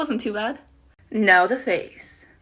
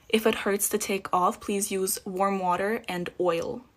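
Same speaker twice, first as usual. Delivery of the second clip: ordinary talk, nothing marked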